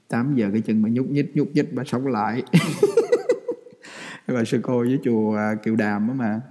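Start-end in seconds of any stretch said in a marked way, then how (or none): 2.5-4.2 sudden high-pitched laugh; high burst of laughter. Real, not acted. A monk laughing at his own story, a high burst of laughter. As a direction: let a squeal of laughter escape.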